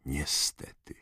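plain reading voice

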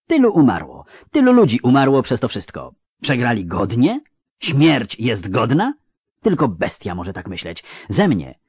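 put-on young woman's loud, bitter reproach; Ciri, furious outburst. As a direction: hurl the words at the emperor in anger. Ciri's angry outburst.

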